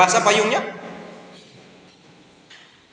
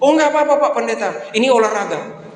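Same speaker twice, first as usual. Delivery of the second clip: much higher and louder, a bright eager helper; cheerful worker; 9 semitones higher, 4 dB louder than usual. Retold or imitated. Old worker tells the pastor it's exercise, high and eager. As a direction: play the keen neighbour brushing off thanks.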